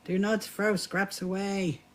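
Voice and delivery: usual speaking voice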